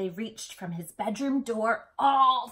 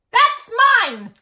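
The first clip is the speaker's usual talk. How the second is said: loud, high cry of ownership; Elmore's outburst; 14 semitones higher, 17 dB louder than usual. A narrator's reading. Big brother claiming his outfit, loud and high. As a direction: snap it out like a possessive big brother. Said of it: "said Elmore".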